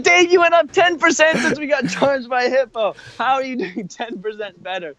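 high-pitched, loud incredulous protest; amused exasperation, voice shooting up; laughing disbelief. Retold or imitated. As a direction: exclaim through laughter in mock outrage. Laughing, astonished reply to friend's claim.

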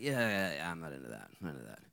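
the woman dismissing the pastor's faith, coldly; cold brush-off. Retold or imitated. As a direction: shut the topic down coolly. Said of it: "she kinda got cold".

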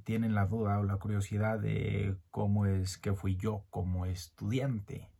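ordinary delivery

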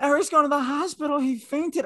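very high, quick mock panic; frazzled. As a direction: blurt it out high, imitating a frantic friend. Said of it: "sounded frazzled".

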